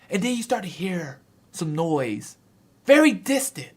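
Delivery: normal talking voice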